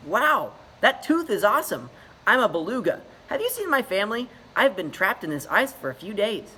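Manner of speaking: put-on high, loud chirpy young voice; bright eager greeting; cheerful beluga. Trapped beluga Dilly greets Ichabod, high and chirpy.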